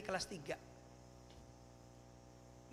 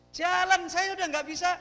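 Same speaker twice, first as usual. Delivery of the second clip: higher, louder rush of alarm; panicked alarm; 10 semitones higher, 9 dB louder than usual. Retold or imitated. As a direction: relive the brakes giving out. Becak rolling, brakes failing. (panik, suara meninggi dan keras)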